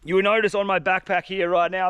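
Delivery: ordinary talk, nothing marked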